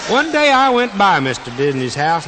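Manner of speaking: ordinary delivery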